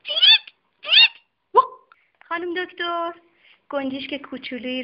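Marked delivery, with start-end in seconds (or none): 0.0-1.7 high squeaky chirps between barks; a scared dog letting slip a sparrow's chirp; timid dog chirping. Acted. Frightened dog chirping like a sparrow, high. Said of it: "آروم گفت".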